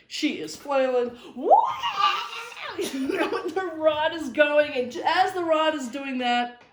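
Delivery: can't stop laughing while telling a friend the snake story; laughing through it. Real, not acted. Laughing hard, voice jumping high.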